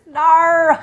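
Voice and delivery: loud, high, mortified protest; embarrassed no. Real, not acted. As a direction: cringe out loud at yourself. Embarrassed about Australian viewers.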